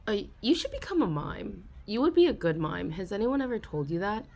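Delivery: ordinary delivery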